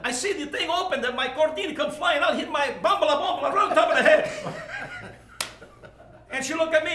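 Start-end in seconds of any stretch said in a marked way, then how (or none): 4.4-5.1 high giggle. Real, not acted. Laughing at his own story.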